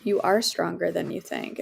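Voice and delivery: ordinary talk, nothing marked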